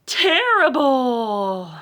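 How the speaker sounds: reading Terrible with a big spooky pause; dramatic title word. Shown as a demonstration. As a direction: stretch out Terrible and lean on it. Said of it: "Terrible, like, mean, ferocious?".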